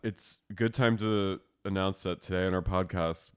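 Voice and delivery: ordinary talk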